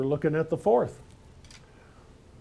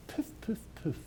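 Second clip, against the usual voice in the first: breathy mock poof; 4 semitones higher, 14 dB quieter than usual. Mock blowing away the enemy.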